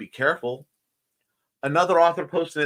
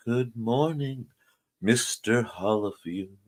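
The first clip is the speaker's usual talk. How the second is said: slow, low, softer sing-song "Good morning, Mister Hollifield"; mock formal greeting; 4 semitones lower, 7 dB quieter than usual. Greeting Mister Hollifield in a slow, low put-on voice. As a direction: drawl a mock-formal hello.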